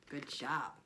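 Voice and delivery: ordinary delivery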